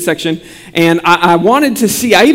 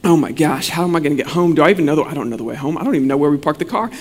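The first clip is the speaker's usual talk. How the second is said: soft, fast, lower worried murmur to himself; panicked inner thoughts; 6 semitones lower, 6 dB quieter, much faster than usual. Retold or imitated. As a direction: rush the frightened thoughts under your breath like a lost child. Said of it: "And so I just think to myself".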